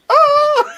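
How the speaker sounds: sudden high scream of fright. Retold or imitated. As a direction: shriek as if jump-scared. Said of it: "I was like".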